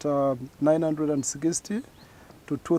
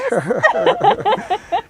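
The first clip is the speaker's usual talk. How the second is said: loud laugh; 8 dB louder than usual. Real, not acted. Laughing at being called hydrologist.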